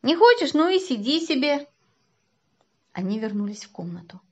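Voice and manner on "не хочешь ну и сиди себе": громкое высокое объявление; объяви во весь голос, с досадой; крик. A narrator's reading. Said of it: "закричал Котька". (кричать с досадой)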